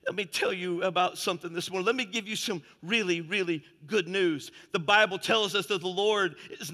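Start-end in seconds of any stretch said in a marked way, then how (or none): none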